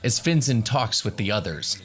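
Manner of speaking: ordinary talk, nothing marked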